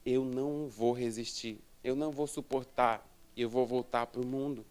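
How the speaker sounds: low, soft, sinking prayer; hushed confession. Retold or imitated. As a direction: confide it quietly to God. Retold confession to God sinking low.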